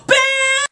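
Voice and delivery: very high shouted bam; sudden bam. Pretend. Gamer bursting out mid-apology, high bam. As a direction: fire it off sky high.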